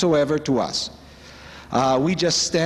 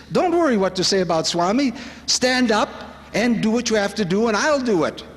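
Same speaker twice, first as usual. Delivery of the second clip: higher-pitched, rousing reassurance; rally a hesitant follower, pitch lifted; lightly acted teacher urging action; Swami's encouragement; 7 semitones higher than usual. Quotes Swami urging courage.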